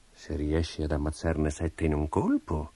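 lightly voiced king, low and slow; musing king. A narrator's reading. King reads of the giant-killer's deeds, musing quietly. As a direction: softly weigh up the idea.

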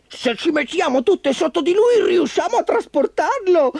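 very high, eager little-car voice; Grinta. A narrator's reading. Little car suggesting how to carry Ford, high eager voice. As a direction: pipe up with a suggestion. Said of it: "suggerì Grinta".

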